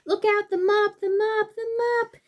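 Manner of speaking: high, loud warning cry; alarmed shout. Acted. Animals warning each other of the mop, high and loud.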